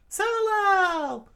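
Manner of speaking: very high, loud call; screamed. Acted. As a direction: scream his name. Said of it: "Vivi screamed out".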